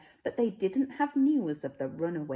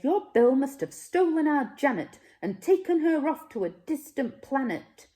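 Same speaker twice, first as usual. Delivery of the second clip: louder and higher, angry accusation; accusing; 8 semitones higher, 6 dB louder than usual. Acted. Smeds accusing the Smoos of stealing Janet, loud and heated. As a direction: blame the other family hotly.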